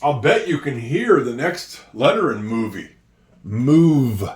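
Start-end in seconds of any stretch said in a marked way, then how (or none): 3.4-4.3 "Move" with buzzing V; stressed V. Shown as a demonstration. Teacher stressing the V in "Move" for pupils. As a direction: press the V in "Move". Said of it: "Do you hear".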